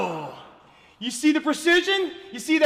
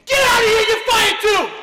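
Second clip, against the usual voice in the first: loud, higher furious dismissal; angry yell; 6 semitones higher, 3 dB louder than usual. Acted. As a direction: shout the firing in a rage. Firing the second assistant.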